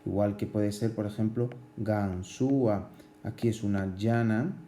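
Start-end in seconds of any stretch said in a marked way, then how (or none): 1.8-2.9 "Gansúa" slowly as gan-sú-a; hiatus by syllables. Shown as a demonstration. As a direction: stretch "Gansúa" out, separating ú from a. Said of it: "pero con hiato".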